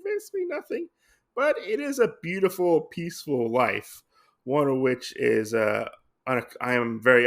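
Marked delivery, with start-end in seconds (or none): 0.0-4.0 lightly voiced old general, pitched much higher; quoting the old general. Retelling the general's words to Usagi, a lightly voiced high old man. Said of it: "Ononeko says".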